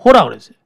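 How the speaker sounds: "호라" punched loud, falling; emphatic answer. Real, not acted. Teacher repeating the answer to the class, stressing "호라". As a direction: drive "호라" home so it sticks.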